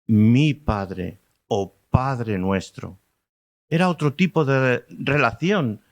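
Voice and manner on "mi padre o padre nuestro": very slow, leaning hard on "mi" in "mi Padre"; stress on mi. Shown as a demonstration. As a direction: linger on "mi Padre o Padre nuestro", weight on "mi". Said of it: "nunca de la manera en que Jesús nombró a".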